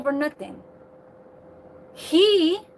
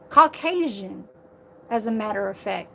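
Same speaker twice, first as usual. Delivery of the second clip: ordinary talk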